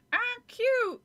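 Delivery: high, slow, through gritted teeth; ventriloquist's high dummy voice; squeaky dummy voice. Acted. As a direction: speak for the puppet without moving your lips. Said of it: "she made him say".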